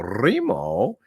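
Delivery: imitated Italian phone yell, rolled R; Remo. Old Italian boss picks up the phone, mock yell. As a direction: call out the name with a trilled R, rising. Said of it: "He yells out".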